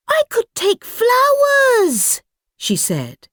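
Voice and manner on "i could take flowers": put-on high, slow little girl; sweet young girl idea. Girl suggests flowers.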